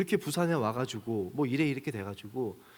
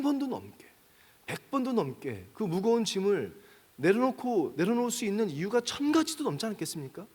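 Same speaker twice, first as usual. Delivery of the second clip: raised, higher preaching voice, pressing the point; 6 semitones higher, 3 dB louder than usual. Acted. Pastor urges his listeners with fervent preaching. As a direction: drive the sermon home with fervour.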